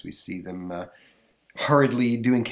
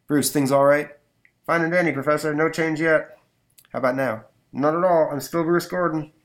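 walkie-talkie voice; 6 semitones higher, 5 dB louder than usual. Acted. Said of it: "doing my walkie-talkie voice".

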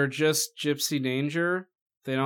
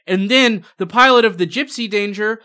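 loud, higher, hyped-up pitch; mock promotional exposition; 5 semitones higher, 8 dB louder than usual. Critic parodying the film's twenty-minute introduction, mock-hyped and loud. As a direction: sell it like an overblown toy plug.

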